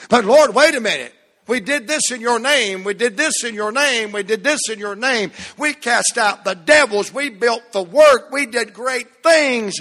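high, loud protesting plea; pleading believers. Retold or imitated. Churchgoers protesting to the Lord at judgment, pleading loudly. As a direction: beg the Lord to reconsider.

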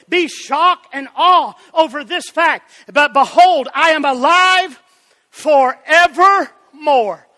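emphatic, stretched proclamation building to a peak; fervent preaching. Real, not acted. Preacher quoting the risen Christ to church, exultant preaching. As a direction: draw out the big words for the congregation.

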